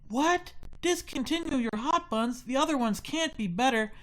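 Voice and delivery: put-on high whiny kid voice. Kid voice in retold story.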